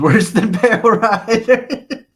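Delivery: high, loud, stretched out by laughter; cracking up while reading. Real, not acted. Streamer reading a tease about his fantasies, cracking up. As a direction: laugh through the punchline.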